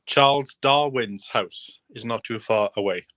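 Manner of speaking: slow, careful naming of Charles Darwin's house; deliberate slow pace on a tricky name; said slowly. Shown as a demonstration. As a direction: spell the names out slowly so they don't get mixed up. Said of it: "I need to say this slowly".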